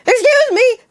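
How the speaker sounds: high, sudden awkward call-out; jumpy high excuse me; awkward blurt. Retold or imitated. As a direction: blurt it out like a nervous mess. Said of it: "being a naturally awkward person".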